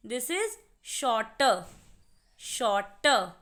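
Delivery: "shorter" said very slowly with no r sound at the end; silent r demo. Shown as a demonstration. Teacher to students showing the silent r, slow and careful. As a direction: stretch out "This is shorter", dropping the final r. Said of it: "शॉर्ट में आपको आर की sound नहीं आ रही".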